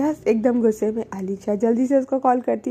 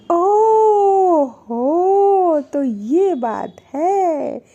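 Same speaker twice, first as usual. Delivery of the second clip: a long, drawn-out, higher and louder "ओह हो", then a slow "तो ये बात है"; teasing realization; 4 semitones higher, 5 dB louder, much slower than usual. Retold or imitated. Alisha to Anu, teasingly catching the real reason. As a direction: savor catching her out.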